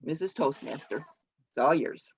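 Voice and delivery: normal talking voice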